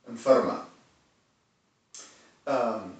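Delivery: ordinary talk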